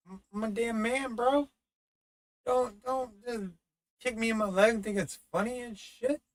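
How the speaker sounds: high, whiny plea to stop; whiny protest. Retold or imitated. Whining at his friend to quit kicking, high pitched. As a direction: squeak out an indignant complaint.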